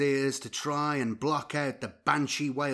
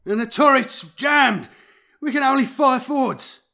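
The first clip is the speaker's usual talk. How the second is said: high, loud frantic yell; yelling crewman; 12 semitones higher, 10 dB louder than usual. A narrator's reading. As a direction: scream the bad news back. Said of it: "yelled back a crewman".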